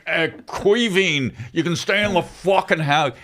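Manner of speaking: high mocking voice of a spoiled rich kid; hammed-up posh brat voice; Johnny Property Pants. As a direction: lord it over them like an entitled landlord's son. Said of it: "Johnny Property Pants".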